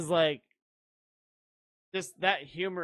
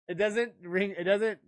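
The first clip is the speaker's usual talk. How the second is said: ordinary talk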